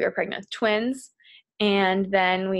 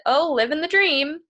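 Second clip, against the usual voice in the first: bright, higher sarcastic quip; 6 semitones higher, 3 dB louder than usual. Retold or imitated. Answering a stranger in town, sarcastic and a bit louder. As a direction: toss it off with a sarcastic lilt. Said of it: "being sarcastic".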